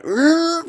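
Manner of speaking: high rising "rrr" car noise; imitated engine rev. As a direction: mimic revving right up behind someone. Imitates engine revving behind old man.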